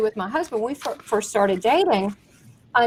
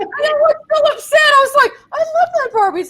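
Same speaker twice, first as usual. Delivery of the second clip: high, louder wailing, half crying; mock wail; 5 dB louder than usual. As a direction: whine it like a heartbroken kid. Reliving Barbie loss upset.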